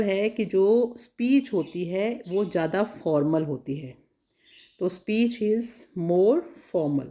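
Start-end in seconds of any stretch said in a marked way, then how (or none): 4.9-7.1 "more" stressed, said very slowly; slow stressed answer. Shown as a demonstration. Teacher dictating the answer slowly, stressing "more". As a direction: spell out "speech is more formal" slowly, leaning on "more". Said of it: "तो इसका answer होगा speech is more formal".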